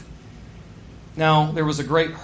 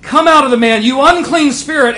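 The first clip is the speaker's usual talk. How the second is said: loud, higher command to the spirit; 8 semitones higher, 11 dB louder than usual. Acted. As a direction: order the demon out with force. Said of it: "he is constantly saying".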